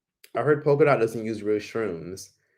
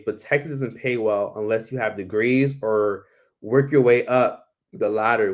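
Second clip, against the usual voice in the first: ordinary talk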